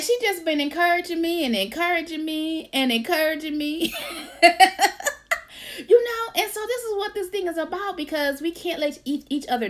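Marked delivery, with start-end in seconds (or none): 4.0-5.8 high bubbling laugh; happy giggle burst; delighted laugh. Real, not acted. Laughing at being encouraged.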